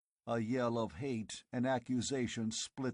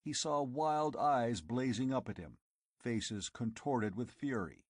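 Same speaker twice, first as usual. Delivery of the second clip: ordinary delivery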